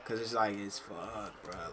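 normal talking voice

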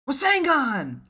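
high, loud battle cry; anime-style attack call; attack shout. Acted. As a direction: yell the technique name. Calls out an attack name.

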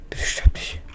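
anxious, voiceless begging; whispered plea. Real, not acted. Player begging a hurt villager not to die, whispered plea. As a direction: plead under your breath in a whisper.

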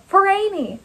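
high, called-out chant; fervent high-pitched cheer; group rallying cry. A narrator's reading. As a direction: cry it as one of a crowd. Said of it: "a dozen women with a single voice".

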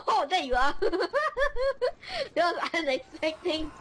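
talking through helpless high laughter; real giggling fit mid-game; uncontrollable laughing. Real, not acted. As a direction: laugh so hard the words barely get out. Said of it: "I cannot stop laughing".

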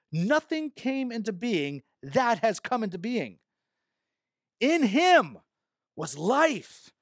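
slow, emphatic Bible reading; measured pulpit reading, key words punched; scripture reading. Acted. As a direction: proclaim each phrase with weight and long pauses. Reads scripture aloud to congregation.

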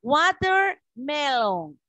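"watermelon" very slow, split as WA-ter, ME-lon; teacher's slow demo of English "watermelon"; English pronunciation. Shown as a demonstration. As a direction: model "watermelon" in drawn-out parts for learners. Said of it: "Estamos aprendiendo a pronunciar".

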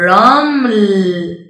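very slow, loud blended word; teacher blending sounds slowly for kids; drawn-out blending. Shown as a demonstration. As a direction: stretch the word out as you blend it. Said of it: "اقرأ وصل الأصوات لتكون كلمات".

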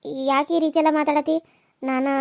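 ordinary delivery

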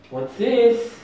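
high teasing voice to a dog; excited dog talk. Real, not acted.

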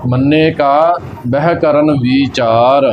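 slow line, resting on ਕਾ, none on ਬਹਿ; pause after ਕਾ. Shown as a demonstration. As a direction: break after ਕਾ, run ਬਹਿ onward. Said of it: "ਕਾ ਤੇ ਵਿਸ਼ਰਾਮ ਲੱਗਣਾ ਹੈ".

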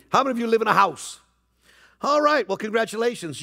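ordinary talk, nothing marked

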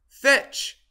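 loud, high crow of a command; crowing call. A narrator's reading. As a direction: crow it out like a rooster. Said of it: "he crowed".

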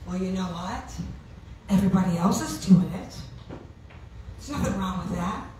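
low, hushed voice of temptation; tempting inner voice. Acted. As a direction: slip it in low, like a persuasive thought. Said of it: "you might hear a different voice saying this".

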